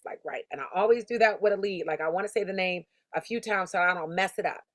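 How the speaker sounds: normal talking voice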